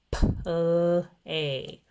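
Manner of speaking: "P, l, a" as a puff, a long held l, then "ay"; stretched-out sounds. Shown as a demonstration. Teacher stretching out a rhyming word, sounded out. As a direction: sound out "P, l, a" slowly, sound by sound. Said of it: "Stretch that word out".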